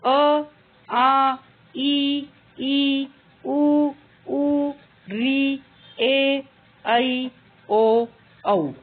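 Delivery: each Hindi vowel said clearly and apart, with a pause after each; स्वरों का स्पष्ट उच्चारण; reciting vowels. Shown as a demonstration. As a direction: recite the vowels slowly for a class. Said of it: "Hindi भाषा में ग्यारह स्वर होते हैं".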